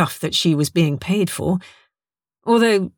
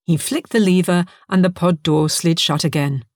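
plain reading voice

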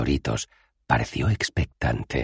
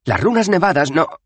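louder, high annoyed reply; snappy retort; 7 dB louder than usual. Acted. Wizard snapping at Dos Flores, annoyed. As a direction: snap at him.

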